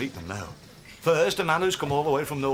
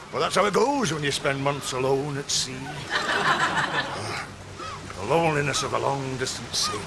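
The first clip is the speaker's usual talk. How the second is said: put-on salty old sea dog; 3 semitones higher than usual. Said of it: "the salty old sea dog".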